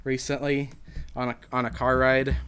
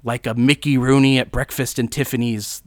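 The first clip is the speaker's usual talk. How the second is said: Jack Nicholson; 6 dB louder than usual. Acted. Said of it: "And Jack's like".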